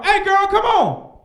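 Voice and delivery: high, loud, slow pickup call; flirting on a broom.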